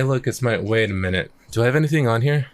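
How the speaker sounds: ordinary delivery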